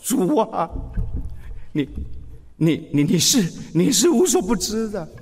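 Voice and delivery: halting, choked-up repeated "你"; a broken man barely getting the words out; grieving confession, stumbling over each "你"; mournful stammer. Retold or imitated. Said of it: "很难过、很哀伤的对着说".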